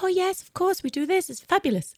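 high, soft gushing reply; retold eager agreement. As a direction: play the teacher lightly enthusiastic. Retelling an eager teacher's answer.

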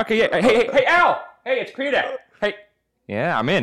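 high, excited cartoon dad call; Peter Griffin. Crasher hailing a supposed friend inside, cartoon impression. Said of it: "in Peter Griffin accent".

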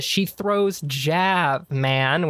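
slow scoffing punchline drawl; retelling the punchline to a van, slow and drawn; slow knowing drawl. Retold or imitated. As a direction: land the punchline slowly. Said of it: "I get to the".

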